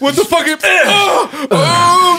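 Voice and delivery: high frightened yelps; panicked cry. Acted. Man with police at his door, crying out in fear. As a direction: gasp out in terror.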